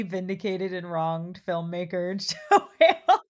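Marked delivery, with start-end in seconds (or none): 2.5-3.2 laughing name. Real, not acted. Laughing at wronged filmmaker.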